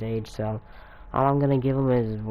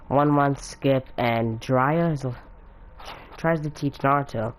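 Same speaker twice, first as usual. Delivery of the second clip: plain reading voice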